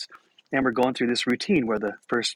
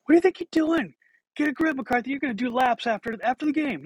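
high-pitched mock scolding; angry coach; 13 semitones higher than usual. Coach threatening laps after the game, high mock scolding. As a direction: play a fed-up coach, voice pitched way up. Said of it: "the coach is like".

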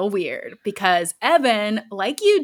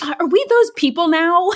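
high, louder, incredulous; exasperated wife; 9 semitones higher, 5 dB louder than usual. Retold or imitated. Wife to costumed husband, high and incredulous. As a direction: ask it like you can't believe your life. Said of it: "And she goes".

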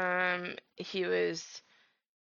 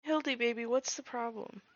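cooing at the cat; 7 semitones higher, 4 dB louder than usual. Real, not acted. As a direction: coo at your cat, higher and louder as she gets praised. Talking to her cat.

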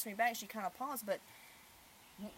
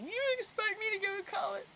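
much higher, mock-incredulous teen voice; mimicking daughter; 13 semitones higher, 6 dB louder than usual. Mother mimicking her daughter's question in a high voice. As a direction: toss it back like a baffled teenager. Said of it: "she threw this on me".

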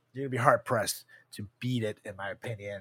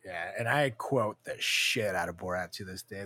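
ordinary delivery

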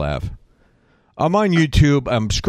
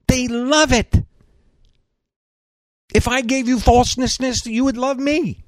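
mock high voice; 8 semitones higher than usual. Real, not acted. Mocking people who love falseness.

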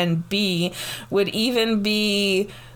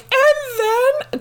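high-pitched mock sobbing; imitated child's crying; 4 dB louder than usual. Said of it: "like, she was crying".

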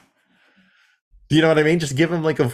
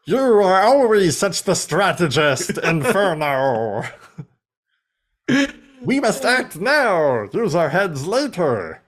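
mock Predacon; 6 semitones higher, slower than usual. Mocking Predacon banter.

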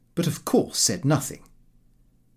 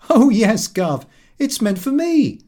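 laughing exclamation; 9 semitones higher, 6 dB louder than usual. A narrator's reading. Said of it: "exclaimed with a laugh".

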